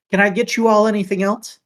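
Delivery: high, loud, quick eager offer; eager shopkeeper. Acted. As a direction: rush the offer brightly. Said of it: "and says".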